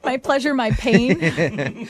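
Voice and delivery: laughing. Real, not acted. Co-host repeats guest's joke laughing.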